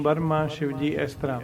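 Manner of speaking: ordinary talk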